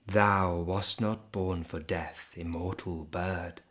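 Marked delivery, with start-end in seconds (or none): none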